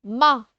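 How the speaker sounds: MA alone and loud; isolated syllable. Shown as a demonstration. Teacher continuing camarade for pupils, isolated syllable. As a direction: punch MA out by itself. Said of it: "je commence".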